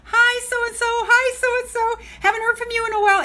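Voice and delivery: high, bright-eyed chirpy hello; cheery group greeting. Retold or imitated. Imitating friends greeting each other on the call, chirpy and high. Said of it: "you're all saying".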